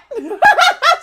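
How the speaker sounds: sudden high burst of laughter. Real, not acted. Laughing at the raid reveal.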